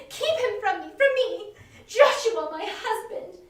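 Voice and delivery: a terrified wife begging for protection; hysterical plea. Acted. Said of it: "raved and cried".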